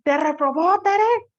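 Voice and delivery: teacher acting amazed at a failed student; shocked question. Shown as a demonstration. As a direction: gasp it out in disbelief. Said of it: "Con una sorpresa así".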